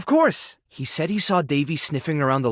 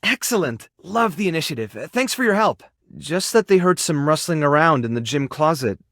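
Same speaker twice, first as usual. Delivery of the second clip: ordinary talk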